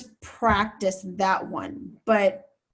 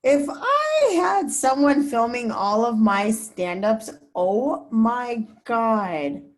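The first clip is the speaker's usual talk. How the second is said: emphatic exclamation; 2 semitones higher, 4 dB louder, much slower than usual. Real, not acted. Reporter reacts to the idea of someone filming her stand-ups, emphatic exclamation. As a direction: stretch the wish out with big emphasis. Longing reaction to question about help.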